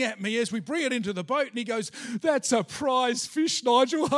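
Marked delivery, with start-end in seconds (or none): 2.2-4.2 slow, loud crow breaking into laughter; laughing. Retold or imitated. Friend teasing Nigel as the fish comes aboard, laughing. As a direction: imitate a friend laughing over the catch. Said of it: "And he's laughing".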